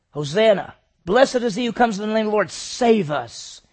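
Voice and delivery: loud, high, fast jubilant cry; crowd praise. Acted. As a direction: hail him like a cheering crowd. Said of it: "were going".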